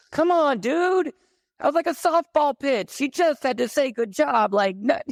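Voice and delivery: exasperated. Retold or imitated. Retelling dad's failure to the host, exasperated and high. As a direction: throw up your hands in disbelief.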